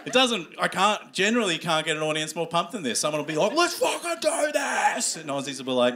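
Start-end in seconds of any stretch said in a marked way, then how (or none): 3.5-5.2 high, drawn-out mock war cry; mock hype yell. Comic imitating someone firing up the audience, hyped yell. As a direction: imitate a pumped-up crowd member yelling.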